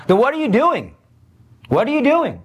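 high-pitched challenge, falling at the end; pointed rebuke, pitched well up; challenging. Acted. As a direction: confront them with an incredulous question. Preacher challenges negligent parents in acted questioning.

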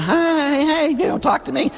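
very high, plaintive spouse voice, a little mocking; ignored spouse's plea. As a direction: squeak it out like a neglected partner.

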